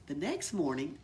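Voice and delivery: ordinary delivery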